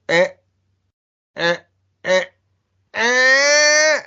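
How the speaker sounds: short bleats building to one loud, high honk; imitated awkward honking laugh; George McFly laugh. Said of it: "laughs similar to George McFly".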